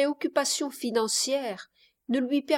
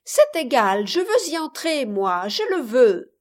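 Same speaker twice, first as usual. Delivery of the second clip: put-on loud, insistent child's voice; wilful little girl; 3 semitones higher, 5 dB louder than usual. A narrator's reading. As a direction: stamp out the demand like a spoilt child. Child insists against mother's refusal. (fillette têtue qui exige)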